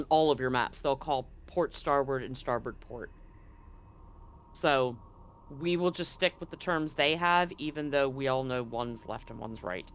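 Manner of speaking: normal talking voice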